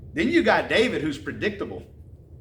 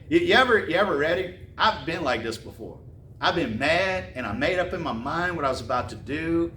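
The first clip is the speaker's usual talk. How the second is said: ordinary talk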